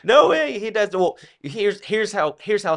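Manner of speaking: loud, high-pitched exclamation; excited outburst. Real, not acted. Co-host jumps in on the Doritos joke, excited outburst. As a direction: burst in high and loud, then start explaining.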